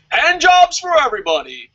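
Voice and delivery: high, loud hammed-up announcement; sarcastic over-the-top hype; mock excitement. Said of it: "I'm being sarcastic here".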